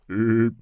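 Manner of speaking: short loud burp-like grunt; weird grunt. Retold or imitated. Streamer imitating Uncle Aaron's odd noise, loud grunt. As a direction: blurt a loud erp noise. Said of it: "and he goes".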